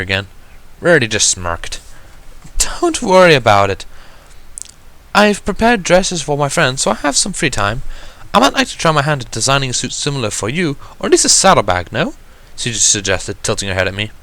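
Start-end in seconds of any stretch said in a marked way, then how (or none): 2.6-12.1 higher, refined lady gently correcting; a poised unicorn gently reminding her sister; Rarity's voice. Acted. Rarity reminding Sweetie Belle, higher and refined.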